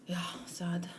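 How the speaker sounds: whispered, real sadness trailing off; sad whisper. Real, not acted. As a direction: breathe it out, let it sink. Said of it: "I'm really sad".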